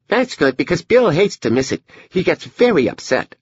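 put-on very high little-girl voice; Edie, seven-year-old girl. Daughter talks about her brother Bill, high child voice. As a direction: speak up high as a small, earnest child.

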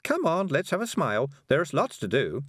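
high, chivvying cheer; jolly someone along with a lilt; cheerful. A narrator's reading. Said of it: "said Miss Level cheerfully".

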